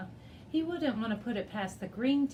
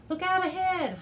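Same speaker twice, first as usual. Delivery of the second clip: high carrying call; urgent warning shout; 10 semitones higher, 6 dB louder than usual. A narrator's reading. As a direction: call it out across the water. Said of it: "Javier called out".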